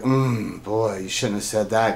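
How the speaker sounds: soft, low aside imagining an onlooker's dismay; muttered dismay. Retold or imitated. Onlooker reacting to Joe's remark, low muttered dismay. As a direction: imitate an onlooker quietly disapproving, low. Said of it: "His eyes went down when he said".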